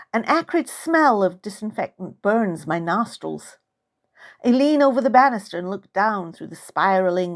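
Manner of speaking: usual speaking voice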